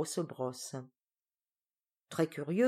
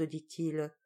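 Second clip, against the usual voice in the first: usual speaking voice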